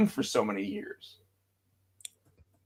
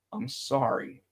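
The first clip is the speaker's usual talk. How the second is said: softer, settled sorry; calmer sorry; 3 semitones lower, 8 dB quieter, slower than usual. Retold or imitated. Punk calming his second sorry, softer. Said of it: "he calmed down a little bit more".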